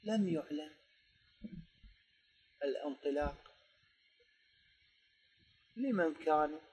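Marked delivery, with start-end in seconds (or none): none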